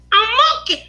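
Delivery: put-on high squeaky Mickey Mouse-like toddler voice; Justin as a little boy. Little son answers his mother about the pencil, in a high child voice. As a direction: mimic a small child's high-pitched lisped words. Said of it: "Justin used to sound like Mickey Mouse".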